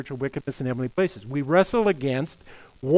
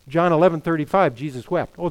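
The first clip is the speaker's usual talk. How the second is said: normal talking voice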